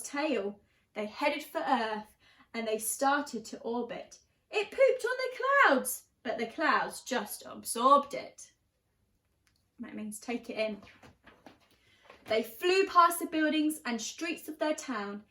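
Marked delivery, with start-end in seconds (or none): 4.5-6.0 high, gleeful; playful emphasis. Acted. Reader hamming the cloud line for laughs. 9.8-10.8 soft explaining aside; quiet aside. Real, not acted. Reader explaining a word to the child, soft. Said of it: "explains word to child".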